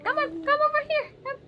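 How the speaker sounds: very high, sing-song coaxing; high cooing call to come closer. Real, not acted. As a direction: coax the little one over in a high cooing voice. Coaxing the baby onto lap.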